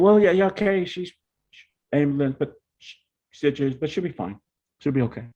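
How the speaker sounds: high, flustered babbling; nervous rush. Retold or imitated. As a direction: blurt it out nervously to dad. Son nervously reporting crash.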